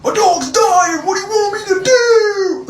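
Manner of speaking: high, loud imitation of wailing; hammed-up anguished yell; mock hysterical wail. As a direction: imitate a melodramatic boyfriend crying out. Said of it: "he starts yelling at me".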